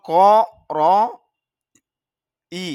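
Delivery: ক, র, ই, each sound alone with pauses; spelled out sound by sound. Shown as a demonstration. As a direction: break the word into single sounds. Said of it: "এখানে খেয়াল করেন".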